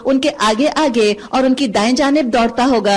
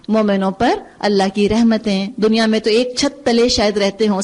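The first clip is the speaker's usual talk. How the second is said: normal talking voice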